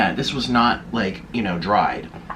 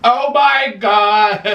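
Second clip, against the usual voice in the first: overjoyed exclamation; 6 semitones higher, much slower than usual. Real, not acted. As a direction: stretch it out in delight. Overjoyed by food.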